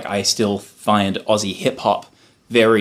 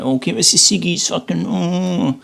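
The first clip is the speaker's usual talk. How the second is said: Michael Cusack impression; 8 semitones higher than usual. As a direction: whine it in broad Aussie, stretching the last words. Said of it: "Michael Cusack has that, like, really famous old clip".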